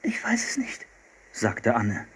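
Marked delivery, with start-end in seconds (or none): 0.0-0.9 whisper. A narrator's reading. Frightened kids near a bear.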